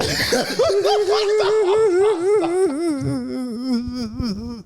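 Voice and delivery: long, high howl of laughter; helpless laughter. Real, not acted. Host cracking up at the crying caller, helpless laughter. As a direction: burst out laughing and let it trail on.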